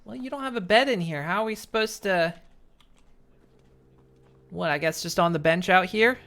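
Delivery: ordinary talk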